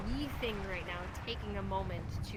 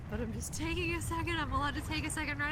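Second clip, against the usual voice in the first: higher, anxious self-talk; nervous voice talking herself through it; talking herself down from real fear, higher and tense; anxious and rushed; 6 semitones higher, 4 dB louder than usual. Real, not acted. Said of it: "I feel rushed".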